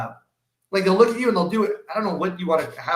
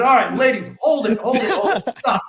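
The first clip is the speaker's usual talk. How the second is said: raised, higher call to halt a passing crowd; loudly flag down the group of women, higher and louder; retold call to stop; 6 semitones higher, 4 dB louder than usual. Narrator stopping thirty women on the street, calling out loud.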